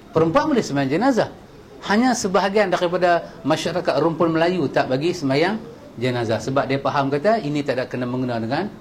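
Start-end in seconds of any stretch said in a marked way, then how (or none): none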